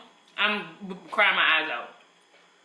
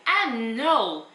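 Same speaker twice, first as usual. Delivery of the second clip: stunned disbelief; 6 semitones higher, slower than usual. Retold or imitated. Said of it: "So I'm like".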